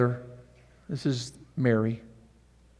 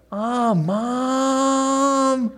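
high, whiny "Ah, Mom"; Jesus as a sulky teen answering his mother; whiny teen son; 10 semitones higher than usual. Acted. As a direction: whine it like an annoyed teenager. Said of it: "it's probably like".

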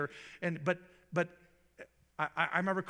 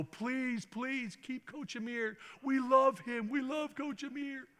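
high, eager pleading voice; lightly voiced eager players pleading; begging players; 9 semitones higher, slower than usual. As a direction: plead like a team of kids begging to keep him. Players begging new coach to stay.

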